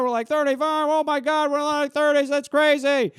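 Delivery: high, strained disbelief; mock amazed. Classmates at reunion marvelling at their age, mock amazed.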